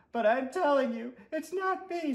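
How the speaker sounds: put-on whiny little boy; high boy voice. Stuart pleading.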